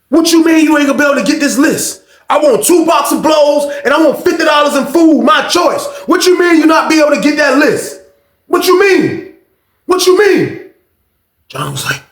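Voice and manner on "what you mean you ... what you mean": high, loud yelling at his cellmate; imitated loud yelling. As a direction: bark the demands like a short angry inmate. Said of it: "he had a loud voice".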